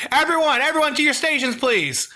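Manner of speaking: high, quick rallying call; urgent command. Retold or imitated. Kelsey sending crew to their stations, urgent. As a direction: call out high and fast like an announcement.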